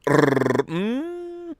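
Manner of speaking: rolled trill soaring into a long high hum; trill, then hum up high and hold it. Shown as a demonstration. Thanks friend by the noise.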